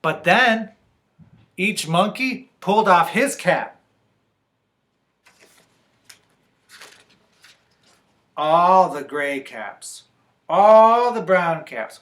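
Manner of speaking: slowing, drawn-out list; dramatic story narration. A narrator's reading. Reader tells children of caps thrown down, drawn-out narration. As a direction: stretch each colour of cap for effect.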